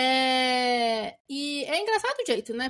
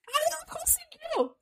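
teasing high squeak; 13 semitones higher, 4 dB quieter, slower than usual. Acted. Teasing punchline about the husband.

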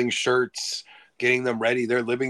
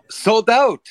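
put-on high, loud Japanese accent; quick Japanese accent shout; 11 semitones higher, 8 dB louder than usual. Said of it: "in a very Japanese accent".

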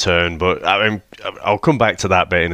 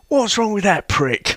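high, put-on incredulous voice; comic outrage pitched right up, laugh close behind; mock disbelief; 12 semitones higher than usual. Retelling the sailors watching the wheelchair, mock disbelief.

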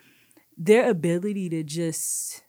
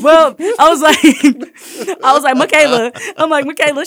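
much louder, higher, laughing through the words; 9 semitones higher, 15 dB louder than usual. Retold or imitated. Teacher bursts out laughing at the children's mix-up, loud and high. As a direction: crack up mid-sentence.

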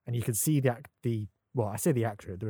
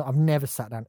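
ordinary talk

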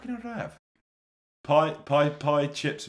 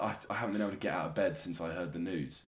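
mock mournful tweet; 8 semitones lower, 9 dB quieter, faster than usual. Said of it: "someone tweeted, like".

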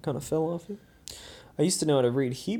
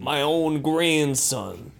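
slow, drawn-out Italian American lament; Italian American accent; much slower than usual. Acted. Grandparent disappointed in grandson, slow Italian American accent. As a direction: play an aggrieved Italian American. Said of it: "Italian American".

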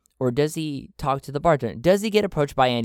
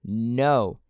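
ordinary talk, nothing marked

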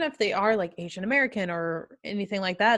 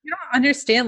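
a higher, louder, insistent "You don't understand"; odd emphatic delivery; 6 semitones higher, 4 dB louder than usual. Pretend. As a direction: press "understand" as if scolding. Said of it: "I don't know why I'm saying it like that".

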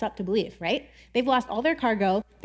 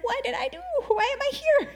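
much higher, bewildered and frightened; panicked sailors; 10 semitones higher than usual. Retold or imitated. Poor sailors caught in storm, high mock panic. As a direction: squeak out the sailors' confusion. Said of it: "they're just like".

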